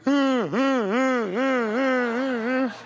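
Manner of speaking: slow high whimpering ahs; slow pained moans. Retold or imitated. Limping out past staring people, slow pained moans. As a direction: groan each step out of the gym. Walking out of the gym hurt.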